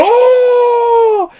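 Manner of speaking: ecstatic high shout; crowd yelling oh. Retold or imitated. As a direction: scream it like a whole crowd losing it. Crowd goes crazy at flip.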